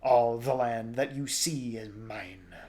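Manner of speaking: slow, possessive monster voice; heavy, stretched-out insect snarl; snarling creature. A narrator's reading. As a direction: draw it out as a menacing claim. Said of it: "the Lubbock snarled".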